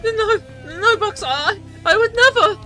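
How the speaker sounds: high, loud, panicked denial; desperate plea. Acted. Iggy denying to Bugs, panicked and high. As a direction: plead it high and frantic.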